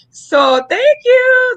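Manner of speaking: high, loud, slow, tearful thank you; overwhelmed thanks. Real, not acted. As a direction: choke out the thanks, moved.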